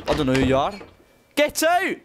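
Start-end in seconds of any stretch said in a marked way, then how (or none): 1.3-2.0 high-pitched yell to clear off; shouted order. Pretend. Man changing clothes to onlookers, high shouted order. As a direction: shriek them out of the room. Chasing intruders from the room.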